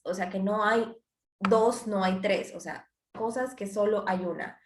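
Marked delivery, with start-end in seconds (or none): none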